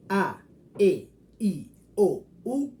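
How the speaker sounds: A, E, I, O, U each pure and separate; Spanish vowels. Shown as a demonstration. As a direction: spell out A, E, I, O, U slowly and clearly. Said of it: "Esas son las cinco vocales". (vocales españolas puras, una por una)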